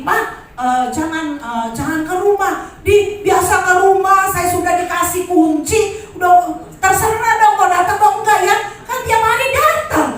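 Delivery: high, loud retold daughter telling mum to stay away; imitating a daughter who won't let mum come over; daughter's voice. Daughter quoted forbidding a visit.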